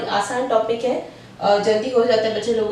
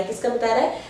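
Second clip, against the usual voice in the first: ordinary talk, nothing marked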